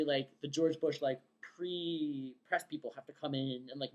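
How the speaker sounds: usual speaking voice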